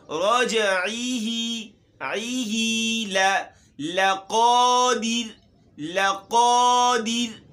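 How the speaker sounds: slow chant with a heavy ر and a bouncing ج in رجعہ; tajweed recitation. Shown as a demonstration. Teacher repeats the verse with the rules, slow chant. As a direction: recite slowly, minding ر and ج. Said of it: "را موٹی ہے اور جیم ساکن ہے".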